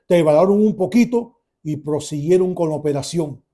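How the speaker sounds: normal talking voice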